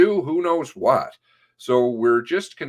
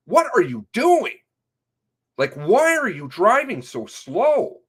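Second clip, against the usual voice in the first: angry yell; 9 semitones higher, 4 dB louder than usual. Retold or imitated.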